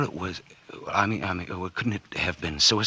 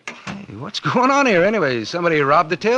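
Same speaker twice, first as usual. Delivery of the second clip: louder and higher, mock-hearty demand; loud feigned bluster; 11 semitones higher, 8 dB louder than usual. Arthur bluffs innocence to the officers, loud blustering. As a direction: play the innocent boss barging in.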